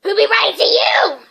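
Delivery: loud, high sneering voice; mock him with contempt. A narrator's reading. Uncle Vernon sneers at Harry, loud and scornful. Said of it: "sneered Uncle Vernon".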